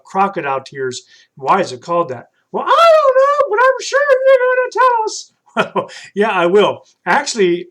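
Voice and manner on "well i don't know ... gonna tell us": put-on high, loud kid puppet voice; bright high puppet calling a friend. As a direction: call out like an eager little puppet. Puppet Troy teases Milt.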